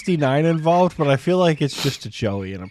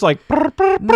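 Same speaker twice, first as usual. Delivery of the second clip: buzzing brr, higher and louder; sawing noise; 13 semitones higher, 7 dB louder than usual. Retold or imitated. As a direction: make a sawing sound. Imitating a nose being sawn off.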